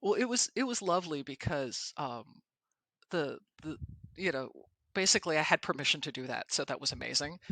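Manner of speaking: usual speaking voice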